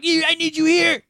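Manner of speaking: put-on high, whiny drunk plea; mock drunk friend. Drunk friend begging on the phone, high whiny voice. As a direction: whine it like a drunk begging for a ride.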